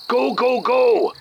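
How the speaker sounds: sharp rising shout of go; urgent go command. Acted. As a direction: fire off the go order urgently. Agents launching an operation.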